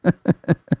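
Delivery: a quick high laugh; short laugh. Acted. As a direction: let out a brief amused laugh. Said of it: "I laughed".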